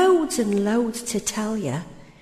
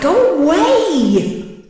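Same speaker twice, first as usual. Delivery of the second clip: slow, loud and higher command; loud dismissal; 6 semitones higher, 8 dB louder, much slower than usual. Acted. Poet banishing Desire, a loud, slow cry. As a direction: draw it out and send her packing.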